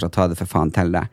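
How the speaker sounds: ordinary talk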